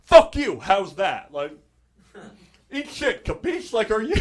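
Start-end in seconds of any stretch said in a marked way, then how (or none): 0.0-1.3 Philly fan. Retold or imitated. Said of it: "People in Philly love to tell you". 2.7-3.7 mock Philly insult. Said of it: "People in Philly love to tell you".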